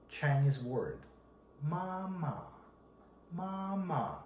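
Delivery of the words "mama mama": "mama" held high and level, no movement; flat first tone. Shown as a demonstration. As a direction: say "mama" on one high, even pitch, softly. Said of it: "The first one is flat tone".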